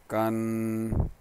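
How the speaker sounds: slow, even sing-song spelling chant; recitation drill. Shown as a demonstration. Teacher drilling students on tanween sounds, in an even sing-song chant. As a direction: spell out each letter like a patient Qaida teacher.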